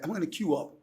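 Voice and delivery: lightly imitated British accent; British English accent. Shown as a demonstration. Showing the host how England says it, British accent. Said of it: "Na Inglaterra".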